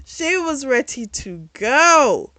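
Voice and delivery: loud, slow, high, drawn-out punchline; amused emphasis. Real, not acted. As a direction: stretch it out with amused relish. Amused emphasis after laughing.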